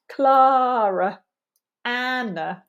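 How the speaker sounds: "Clara" and "Anna" with long first syllables; drawn-out first beat in "Clara" and "Anna"; strong-weak stress. Shown as a demonstration. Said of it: "placing the stress on a certain syllable".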